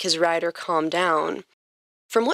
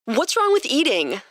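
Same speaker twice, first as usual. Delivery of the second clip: plain reading voice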